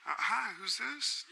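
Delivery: high, drawn-out imitated voice.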